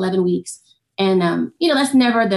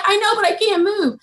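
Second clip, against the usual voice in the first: frozen panic; 10 semitones higher than usual. Retold or imitated.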